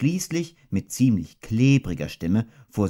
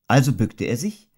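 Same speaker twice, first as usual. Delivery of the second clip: ordinary delivery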